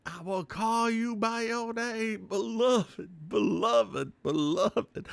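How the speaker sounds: high, slow ghostly calling; eerie ghost voice. Retold or imitated. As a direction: call out like a lonely ghost girl. Ghostly call from a movie.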